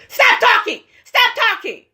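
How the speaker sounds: high-pitched mock screech; mock Toya outburst. Recapper mimicking wife silencing her husband, high hammed-up yell. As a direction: imitate a fit of ordering silence. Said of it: "Here go Toya".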